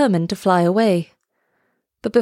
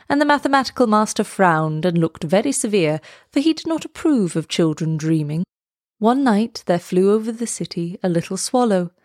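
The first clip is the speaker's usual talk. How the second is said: plain reading voice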